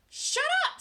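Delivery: very high loud scream. A narrator's reading. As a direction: scream it at her. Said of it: "screamed Jeff".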